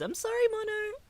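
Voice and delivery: very high, cracking "I'm sorry, Mono"; choked-up squeak. Real, not acted. Streamer apologizing to a hurting viewer, voice breaking.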